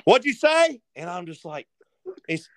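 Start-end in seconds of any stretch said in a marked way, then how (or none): 0.0-0.8 high, loud, yelled across the woods; yelled question. Retold or imitated. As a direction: shout it back, deaf to the hunt. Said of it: "And he yells it".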